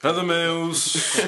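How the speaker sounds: drawn out very slowly, high and loud; teasing name call. Acted. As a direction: stretch the name out loudly.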